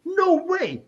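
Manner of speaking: high-pitched, drawn incredulity; disbelief. Retold or imitated. Retells disbelief at coincidence.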